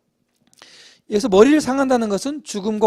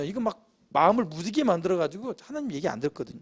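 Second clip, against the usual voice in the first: normal talking voice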